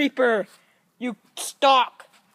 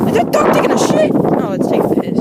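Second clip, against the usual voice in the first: much higher, louder disbelief; surprised outburst; 5 dB louder than usual. Real, not acted. Man seeing a stray dog squat on his lawn, high and loud. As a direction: react loudly to the dog squatting.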